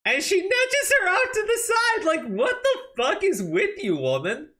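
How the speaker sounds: high, loud mocking falsetto; mock Pelosi voice. Streamer mocks Pelosi shoving a kid, in high falsetto.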